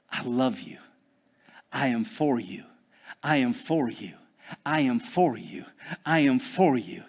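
hushed tender voice of God; gentle reassurance. Acted. Voicing God's gentle reassurance.

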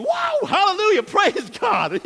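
high, loud wow of praise; hammed-up amazed hallelujah. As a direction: laugh into it, delighted. Reacting to dog praising.

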